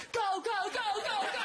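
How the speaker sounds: high, loud "go go go" at the driver; frantic getaway shout; retold shout. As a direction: yell it urgently into the car.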